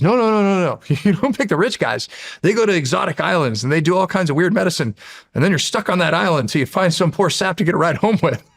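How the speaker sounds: high, lively little demon scoffing. Retold or imitated. Demon Dart scoffs at picking rich guys, high squeaky voice. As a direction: pitch it up like a cheeky little demon. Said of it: "asking Dart questions".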